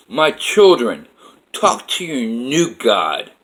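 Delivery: slow, loud proclamation to the faithful; booming divine voice. Retold or imitated. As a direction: address your children as God, drawn out. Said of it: "Then I hear his voice goes".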